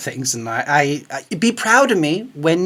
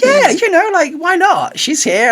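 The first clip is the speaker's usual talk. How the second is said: high, louder carefree reply; breezy Sharon; 4 dB louder, slower than usual. Retold or imitated. Imitating Sharon casually offering the interview, high and breezy. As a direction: toss it off lightly.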